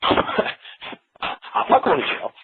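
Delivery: breathy laugh through the words. Real, not acted. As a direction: laugh it off in disbelief. Laughing at the absurd idea.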